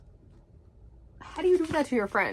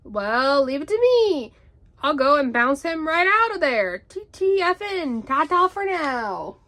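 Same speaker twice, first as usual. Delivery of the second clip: boisterous, louder, swooping high; Tigger; 4 semitones higher, 5 dB louder than usual. A narrator's reading. Tigger offers to bounce Rabbit out, exuberant. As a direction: sing out a bouncy, confident farewell. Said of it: "It's Tigger!".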